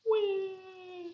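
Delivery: high, soft pretend wail; a playful fake cry for kids; little hammed-up baby wail; mock crying wah. Shown as a demonstration. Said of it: "mouth that shows crying".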